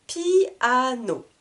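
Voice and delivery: "Piano" drawn out in three syllables; cut into syllables. Shown as a demonstration. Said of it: "Trois syllabes". (« Piano » étiré en trois syllabes)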